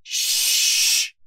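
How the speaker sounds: loud, long librarian's hush; forceful drawn-out "shh"; stern shushing. A narrator's reading. Librarian wagging a finger at the detective, shushing sternly. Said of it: "Mildred instructed, wagging a finger".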